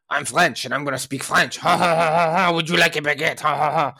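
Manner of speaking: hammed-up French accent with mocking laughter. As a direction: play a snooty Frenchman in a fake French accent. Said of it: "let me do the accent".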